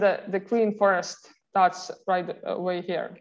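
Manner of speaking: ordinary talk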